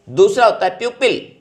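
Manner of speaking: ordinary talk, nothing marked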